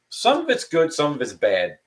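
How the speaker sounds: ordinary talk, nothing marked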